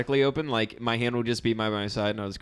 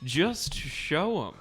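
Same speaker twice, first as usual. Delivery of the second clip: slow; 8 semitones higher, much slower than usual. Real, not acted. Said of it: "answering question slowly".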